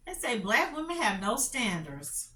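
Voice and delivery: ordinary delivery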